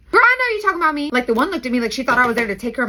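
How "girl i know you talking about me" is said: high sassy callout. Retold or imitated. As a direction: call them out with sass. Sassy aside to the women.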